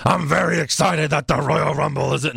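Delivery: lightly voiced wrestler's promo; mock Sean hyping the crowd; imitating Sean. Quoting a wrestler's promo in a light imitation. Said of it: "he says".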